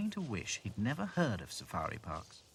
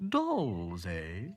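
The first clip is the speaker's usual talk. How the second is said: suspicious giraffe; 2 semitones lower, 3 dB louder than usual. A narrator's reading. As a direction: stretch it out doubtfully. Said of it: "eyed them suspiciously".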